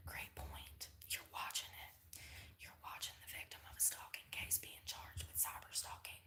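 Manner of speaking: hushed, urgent whisper; worried whisper. Real, not acted. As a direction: whisper it anxiously. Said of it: "Why are we whispering?".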